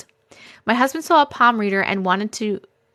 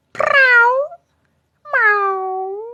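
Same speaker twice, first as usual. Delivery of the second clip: high, loud trilled yowl, then a meow; cat meowing; 12 semitones higher, 5 dB louder than usual. Pretend. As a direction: call out like a cat. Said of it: "The meow sent my cat".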